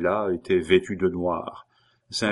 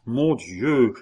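put-on slow, higher cry of an old man; drawn-out exclamation, pitched up; old man's exclamation; 5 semitones higher, slower than usual. A narrator's reading. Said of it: "s'écria le vieillard".

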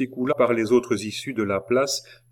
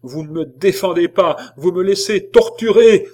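high, loud reproach, building to a shout; impassioned outcry climbing in volume; outraged cry; 9 semitones higher, 10 dB louder than usual. A narrator's reading. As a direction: accuse the whole crowd at full voice. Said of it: "s'écria-t-elle".

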